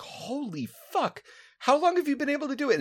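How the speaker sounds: high, stunned disbelief; amazed. Retold or imitated. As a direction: gasp it out in awe. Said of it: "me and Dan were like".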